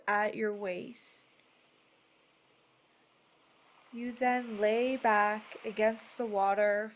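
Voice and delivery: measured, soothing delivery, each phrase given room; guided meditation. Acted. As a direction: guide them gently into peace, unhurried.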